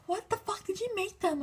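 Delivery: high-pitched imitation of a stunned crowd; mock amazement. Amazed fans asking if she met the band, high mock disbelief.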